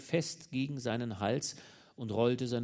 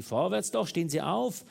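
mocking shout, higher and louder; 5 semitones higher, 6 dB louder than usual. A narrator's reading. Husband scornfully yells at the naked lover to get up. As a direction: bark the order with scorn. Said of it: "indem er schrie".

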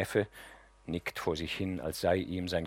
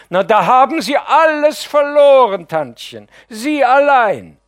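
wailing lament; 17 dB louder than usual. Acted. Nephew wails to the aunt over her loss, loud and high.

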